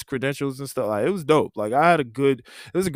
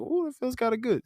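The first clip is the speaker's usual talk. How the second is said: pleased squeal; 12 semitones higher than usual. Retold or imitated. Pleased at praise.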